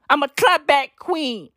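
lightly hammed-up diva sneer; mock strong woman. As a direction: sarcastically play the proud clapback queen. Mocking Chrissy Teigen's tweet persona.

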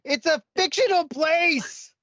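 high, drawn-out protesting yell; exasperated protest. Acted. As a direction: shout it out in mock exasperation. Heated protest against film comparisons.

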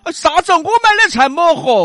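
a loud nosy neighbour lady; old auntie voice. Acted. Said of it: "两个广安老妹儿们儿".

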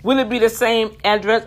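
a curt office clerk asking; imitating the clerk. Clerk quoted, higher louder.

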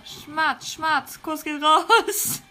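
slow, giggly kisses breaking into laughter; laughing kiss-off. Real, not acted. Streamer blowing kisses to his viewers, giggling. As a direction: laugh through the kisses to the fans.

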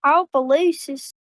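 "Opelousas" said slowly, op-e-LOO-sas; slow, careful "Opelousas"; careful slow pronunciation. Shown as a demonstration. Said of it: "saying the town name".

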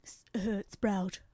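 lightly voiced frail old duchess; quavering old lady, dying farewell; frail withering duchess. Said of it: "Why do I sound like the Duchess?".

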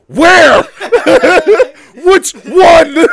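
very high, loud laughing cry; shrieking laughing plea. Retold or imitated. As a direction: beg for the answer while cracking up. Desperate fan question, laughing.

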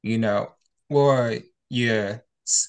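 ordinary delivery

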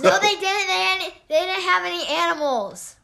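protesting. Real, not acted. Daughter protesting dad's claim.

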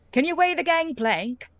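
high goblin voice, fussy and polite; goblin Squab. Acted. Goblin clerk Squab asks the captain, high goblin voice. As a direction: pitch up into the old goblin clerk. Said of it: "and he says".

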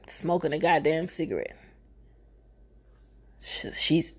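usual speaking voice

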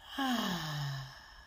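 long audible exhale sigh; loud sigh. Shown as a demonstration. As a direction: release the breath with a sigh. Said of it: "we exhale with a loud sigh".

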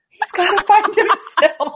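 answering her husband, hammed-up blubbering; mock crying. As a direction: wail it like a weepy mom over a cartoon. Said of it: "started crying".

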